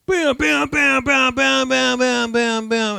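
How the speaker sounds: loud, high volley of bams; high bams gradually stepping down; imitated gunfire. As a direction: fire off rapid mock gunshots. Imitates banker's rapid gunfire.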